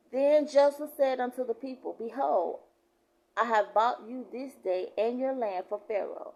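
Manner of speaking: loud preacher's proclamation; scripture reading. Real, not acted. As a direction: declare the verse with force. Reads scripture aloud.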